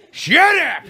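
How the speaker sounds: high, loud goofy yell of shut up. Retold or imitated. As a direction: shout it like a cartoon mom.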